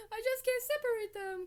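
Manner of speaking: high, loud mock wail; hammed-up sobbing; sobbing professor. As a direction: cry it out like a despairing dad. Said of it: "crying and sobbing".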